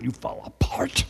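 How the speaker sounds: breathy whispered words, no voice. Retold or imitated. Wife whispering advice to her husband over the phone. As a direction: whisper it like a secret tip. Wife's advice ends hushed.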